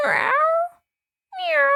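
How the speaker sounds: small cat meowing twice; kitten meows. A narrator's reading. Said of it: "said a voice".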